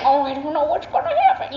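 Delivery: a hint of a nervous baby voice; fake baby voice. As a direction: mimic a nervous star going babyish. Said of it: "a fake baby voice a little bit when she's nervous".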